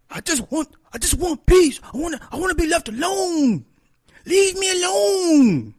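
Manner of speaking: very high, strained wail to be left alone; hammed-up man begging for peace; mock crying shout; whiny mock plea.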